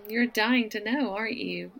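usual speaking voice

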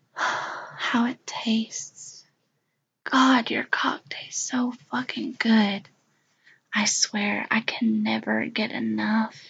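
slow, breathy whisper on "how it tastes". Acted. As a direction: sigh it out on the breath. Intimate erotic whisper.

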